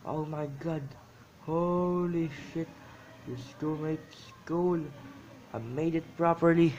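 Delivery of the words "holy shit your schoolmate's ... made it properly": loud, delighted self-praise; proud excitement. Real, not acted. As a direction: brag about the drawing. Proud of own drawing.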